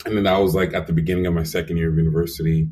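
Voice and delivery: ordinary delivery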